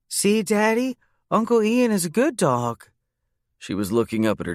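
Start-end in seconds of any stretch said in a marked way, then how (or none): 0.1-2.9 put-on high, cheeky little girl repeating gossip. Niece Bailey telling Uncle Ian he's a dog, in a high child voice. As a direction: play a bold small child tattling. Bailey beaming up at her dad.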